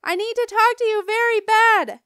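high, loud pleading shout; urgent shout. A narrator's reading. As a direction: shout for mom's attention. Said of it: "I shouted some more".

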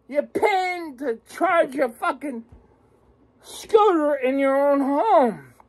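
ordinary talk

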